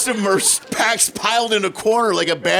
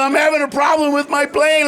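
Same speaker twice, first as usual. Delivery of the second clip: panicked pilot; 8 semitones higher, 4 dB louder than usual. Retold or imitated. Panicked pilot calling tech support.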